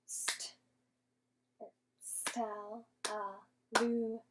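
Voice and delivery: slow syllable by syllable, Stel-la-Lu-na; clapped syllables. Shown as a demonstration. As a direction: clap out each syllable slowly. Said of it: "using our clapping hands".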